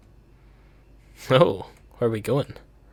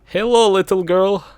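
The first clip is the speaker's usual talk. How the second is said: higher, lightly sinister greeting; a hint of a creepy stranger luring a child; mock menacing hello; 7 semitones higher than usual. Creepy greeting to game character.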